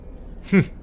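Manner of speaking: single short huff of laughter. Acted. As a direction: laugh briefly at your own joke. Amused at own joke.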